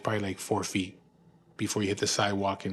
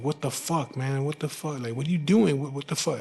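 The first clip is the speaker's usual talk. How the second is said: higher, confused cry of a man just waking from a choke; lightly voiced cellmate, pitched up in shock; dazed cellmate; 5 semitones higher than usual. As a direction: act out the cellmate coming to, bewildered. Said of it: "and he's like".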